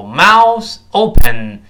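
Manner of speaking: "mouth" and "open" each hit loud; stress on MOUTH OPEN. Shown as a demonstration. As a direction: punch both "mouth" and "open". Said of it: "这个mouth open要重读的".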